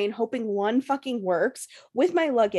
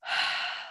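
long, soft breathy exhale; relieved sigh; 8 dB quieter than usual. Retold or imitated. Retold sudden sense of relief.

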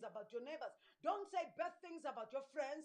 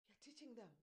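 ordinary talk, nothing marked

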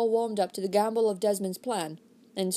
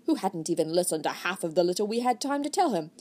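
ordinary delivery, nothing marked

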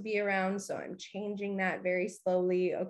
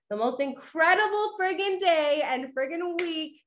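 gushing sign-off; 8 semitones higher, 4 dB louder than usual. Real, not acted. Enthusiastic sign-off.

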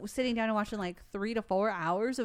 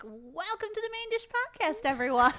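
hammed-up host greeting, pitched way up; mock podcast intro; 10 semitones higher, 4 dB louder than usual. Co-host imitating the other's show opening, hammed-up welcome. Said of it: "She's gonna be like".

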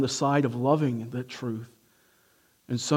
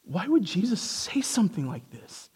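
ordinary talk, nothing marked

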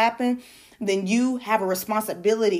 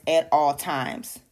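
"at all times" slow and lowered, each word weighted; emphasis on AT ALL TIMES; 4 semitones lower, slower than usual. Real, not acted. Preacher urging obedience to divine guidance, "at all times" slow and emphatic. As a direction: stretch out "at all times" firmly. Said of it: "Emphasis on at all times".

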